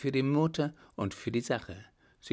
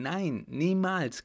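higher and slow, leaning on niemals; mother refusing outright, emphatic niemals; fierce refusal; 7 semitones higher, slower than usual. Acted. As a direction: forbid it firmly, drawing out niemals. Mother fiercely forbids the marriage.

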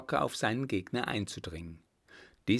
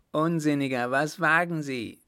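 höher und lauter gerufener Vorwurf; 6 semitones higher, 6 dB louder than usual. A narrator's reading. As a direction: dem Baron erschrocken etwas höher und lauter zurufen. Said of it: "rief Reilhac". (Reilhac ruft dem Baron einen Vorwurf zu, höher und lauter)

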